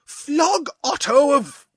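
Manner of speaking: high, slow, cut off; indignant noble outrage; outraged protest. A narrator's reading. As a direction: Otto protesting.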